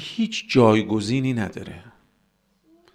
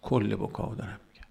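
quiet repeat; 4 semitones lower, 9 dB quieter than usual. Real, not acted. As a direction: say it softly, as a settled aside. Same clarification repeated quietly. (تکرار آرام و با صدای بم)

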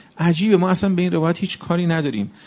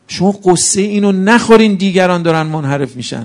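louder sermon at full force; fervent preaching; 4 semitones higher, 6 dB louder than usual. Acted. Preacher urging the congregation not to worry, loud and fervent. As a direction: drive the point home to the crowd.

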